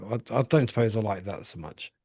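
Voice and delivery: ordinary talk, nothing marked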